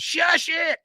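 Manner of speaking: sharp high hush; high kid shush. Retold or imitated. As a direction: hush the grown men like a scolding kid. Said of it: "Bobby turns around and goes".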